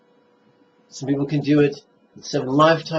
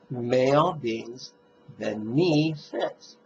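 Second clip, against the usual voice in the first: slow recitation leaning on "May" and the second syllable of "benefit"; stress on MAY and beNEfit; much slower than usual. Shown as a demonstration. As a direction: draw out "May" and the middle of "benefit". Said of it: "you'll emphasize the second syllable of the word benefit".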